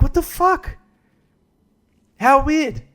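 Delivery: high, far-off baffled cry; shocked. Retold or imitated. Vlogger recalling reaction to Shane news, shocked high cry. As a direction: shock at the casual mention. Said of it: "I'm like".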